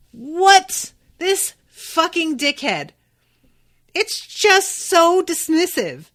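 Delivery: real exasperation at a dismissive character; loud, high-pitched fed-up rant; outraged. Real, not acted.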